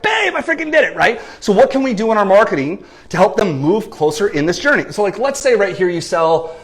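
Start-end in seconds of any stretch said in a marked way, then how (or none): 0.0-0.9 high, thrilled cry of success; a client bursting with joy at the scale. Pretend. Client sees goal weight on the scale, thrilled high cry.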